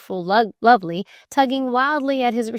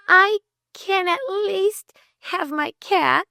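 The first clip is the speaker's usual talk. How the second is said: high, slow self-consoling voice; high-pitched forlorn resolve; girl's plaintive thought; 11 semitones higher than usual. A narrator's reading. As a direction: console yourself in a high girlish voice. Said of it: "she thought".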